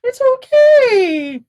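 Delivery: high, tender comforting; calm her down gently; soothing. Acted. Comforting a raging friend.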